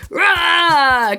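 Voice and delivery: loud high cheer; crowd roar. Acted. Crowd answering the host, loud roar. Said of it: "erupts from the crowd".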